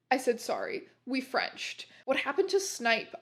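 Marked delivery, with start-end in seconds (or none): none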